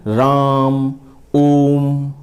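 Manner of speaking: राम and ओम with the vowel held very long, as if calling out; calling prolonged vowel. Shown as a demonstration. Teacher demonstrating how we call out a name, prolonged. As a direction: stretch the last vowel like calling someone far away. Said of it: "किसी को पुकारने में किया जाता है".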